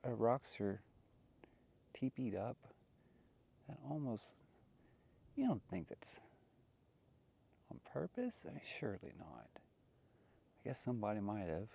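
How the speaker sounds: normal talking voice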